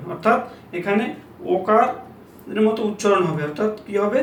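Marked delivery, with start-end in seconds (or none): none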